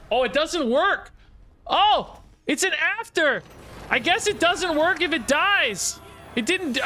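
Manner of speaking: high exclaimed shock of relief; surprised relief. Real, not acted. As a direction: yelp out the disbelief. Opponent's lethal combo fails.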